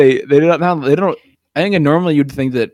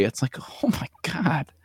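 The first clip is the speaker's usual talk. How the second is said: whispering; 3 semitones higher, 10 dB quieter than usual. Retold or imitated. Exasperated whisper aside.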